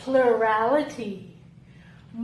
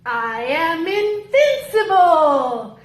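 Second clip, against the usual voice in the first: slow, loud, soaring proclamation; triumphant declaration; 3 semitones higher, 5 dB louder, slower than usual. Acted. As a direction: proclaim it to the heavens.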